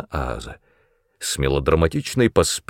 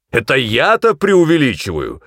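higher, raised-voice outrage; incredulous angry outburst; exploding indignation; 6 semitones higher, 4 dB louder than usual. A narrator's reading. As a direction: blow up at the accusation. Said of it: "снова взорвался мой наставник".

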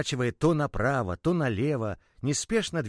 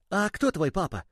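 higher, lightly voiced curious toy; curious little ball; 8 semitones higher than usual. The ball asks the boy about his father, a light high little voice. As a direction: ask it like a small toy.

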